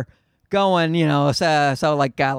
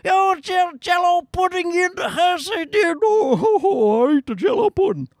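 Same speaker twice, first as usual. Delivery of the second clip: much higher cartoonish Cosby voice that drifts into [name]; squeaky mock Cosby with a laughing whoop; Bill Cosby impression; 11 semitones higher than usual. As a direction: clown through a Cosby bit that turns to [name]. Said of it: "I could be doing Bill Cosby".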